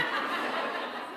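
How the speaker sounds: soft unvoiced chuckle; a quick airy laugh at her own story; short breathless laugh. Real, not acted. Speaker laughing softly at her daughter's line, short breathy chuckle.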